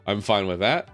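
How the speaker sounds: ordinary talk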